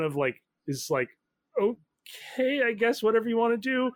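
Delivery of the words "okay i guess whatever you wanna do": higher, hesitant going-along voice; a lightly voiced nervous accomplice; reluctant Angie. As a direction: agree uneasily, pitched up. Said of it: "he's trying not to say anything".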